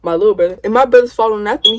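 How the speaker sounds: normal talking voice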